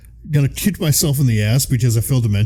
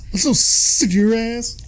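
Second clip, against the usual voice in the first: higher-pitched playful echo of a rant; teasing imitation, pitched up; mock-angry echo; 9 semitones higher than usual. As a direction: parrot the rant in a silly raised voice. Mocking echo of co-host's quote.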